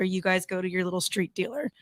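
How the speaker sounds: normal talking voice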